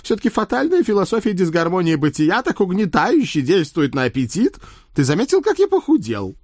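high, conspiratorial talking horse. Acted. Horse voice complaining.

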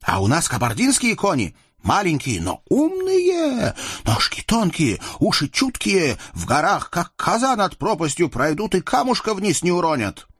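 high-pitched, eager counter-boast; proud mountain youth. A narrator's reading. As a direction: outdo the rival's horses. Yusuf answers praising Kabardian horses.

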